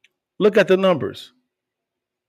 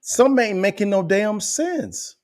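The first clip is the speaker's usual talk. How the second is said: mock exasperated disbelief; 10 semitones higher than usual. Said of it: "Doctor Phil them saying".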